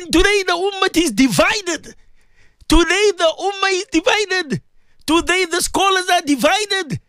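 ordinary talk

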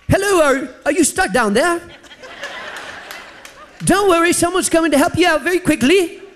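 high-pitched, lilting mock British-Irish accent; attempted Irish accent. English rescuer calling down to elevator, wobbly Irish accent. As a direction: put on a high, badly aimed Irish accent. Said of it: "sounds more like an Irish accent".

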